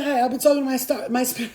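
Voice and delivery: ordinary delivery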